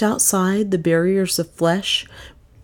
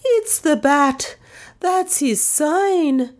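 much higher, slow, frightened cry; terrified cry; 11 semitones higher, much slower than usual. Acted. Maid Lizzie seeing the bat sign, crying out high and slow. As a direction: cry out in terror.

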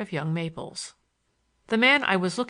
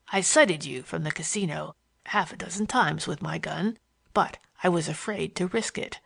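Morgan's voice; 3 semitones lower than usual. A narrator's reading. Morgan taunts the narrator in his Western voice, amused. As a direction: needle him with easy, sly mockery.

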